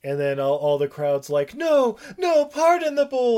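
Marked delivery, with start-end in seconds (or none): 1.6-3.4 high, loud plea; pleading crowd. Retold or imitated. The crowd begs to spare the bull, high and pleading. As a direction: beg for mercy on the bull.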